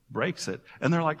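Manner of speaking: usual speaking voice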